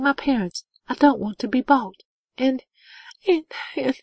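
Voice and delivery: high-pitched, tearful acted outburst; sobbing. A narrator's reading. Woman confessing to a near-stranger, acted high sobbing outburst. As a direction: let it spill out, high and upset. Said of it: "she sobbed".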